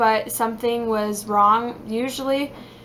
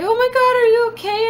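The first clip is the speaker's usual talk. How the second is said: high, loud mock alarm from her fiance; imitating a startled fiance; fiance's alarmed voice; 12 semitones higher, 5 dB louder than usual. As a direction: play the worried fiance rushing in. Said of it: "My fiance runs in the kitchen and is like".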